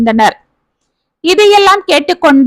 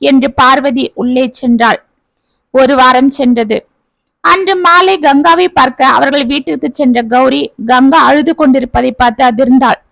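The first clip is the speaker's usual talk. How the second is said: usual speaking voice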